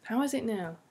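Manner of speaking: ordinary talk, nothing marked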